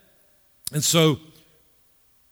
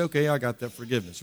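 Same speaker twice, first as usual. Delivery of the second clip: quick, low, offhand reply; breezy, throwaway quote; casual acceptance; 5 semitones lower, 7 dB quieter, faster than usual. Acted. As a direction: toss it off quickly and quietly.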